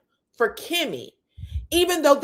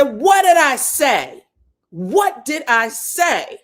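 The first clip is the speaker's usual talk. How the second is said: loud, slow, stretched-out demand; retold defiant challenge; 3 semitones higher, 7 dB louder, slower than usual. Retelling Martell challenging the table, loud and defiant. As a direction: fire the question back loudly.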